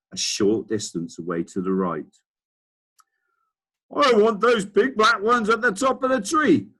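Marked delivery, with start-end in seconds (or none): 3.9-6.7 put-on much higher, louder bossy old man; Duke shouting. Old Duke shouting at his gardener, much higher and louder. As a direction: bark orders like a spoiled old duke. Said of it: "the man was shouting".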